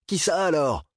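high, loud demanding cry; shouted question. Acted. Bernard shouts at Dap to know who did it, high loud cry. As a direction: yell the demand at the officer. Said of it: "cria Bernard".

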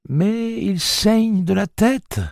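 high, slow, worried cry; frightened for her son; a hint of a distressed mother; alarmed mother.

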